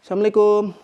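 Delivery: loud high hello into the empty hall; calling out. Real, not acted. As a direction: call out to anyone who might be there.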